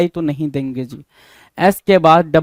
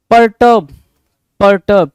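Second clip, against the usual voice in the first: loud word announcement; 3 semitones higher, 8 dB louder than usual. Shown as a demonstration. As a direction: punch out "pur-TUB", pause between repeats. (Perturb ज़ोर से, ऊँचे सुर में बोलें)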